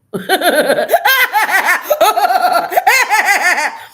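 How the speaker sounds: long shrieking laughter; high loud laugh. Real, not acted. Laughing at herself.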